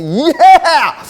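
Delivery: high, loud whoop of excitement; a runner's hype shout before the torch run; pumped-up yell. Retold or imitated. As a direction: let out a fired-up yell. Feeling pumped before running.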